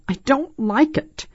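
put-on high, fearful child voice; shuddering. A narrator's reading. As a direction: protest like a frightened little girl. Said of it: "Angela shuddered".

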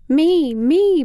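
put-on high, loud, bouncy little-kid chime-in; eager toddler. Little brother eagerly joining his sister, loud and high. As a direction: jump in like an excited toddler. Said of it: "Patrick added".

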